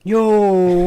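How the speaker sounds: loud, delighted yo; loud excited exclaim. Real, not acted. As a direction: call it out with joy. Tasting the chips, delighted.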